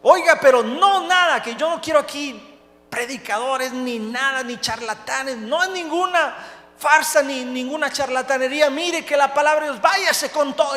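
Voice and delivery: loud, high-pitched outburst, acted out; angry dismissal. Prison director sends preacher packing, angry dismissal.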